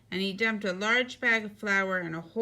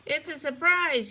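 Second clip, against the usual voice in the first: higher, moderately raised voice; excited yell; 6 semitones higher, 3 dB louder than usual. A narrator's reading. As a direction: kid yelling back a secret. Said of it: "yelled Froggy".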